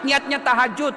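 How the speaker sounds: ordinary talk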